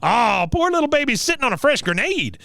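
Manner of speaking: high mock-pitying baby talk; Cotton. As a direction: coo over the baby in a high old veteran's voice. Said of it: "baby talk".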